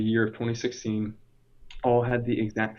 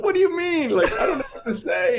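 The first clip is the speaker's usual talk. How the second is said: high, louder comic protest; flustered mock panic; 5 dB louder than usual. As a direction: protest squeakily as if put on the spot. Retells panic at being next.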